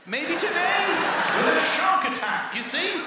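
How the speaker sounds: loud, high, exasperated; exasperated shout. Retold or imitated. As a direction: shout it in disbelief. Arguing about sharks.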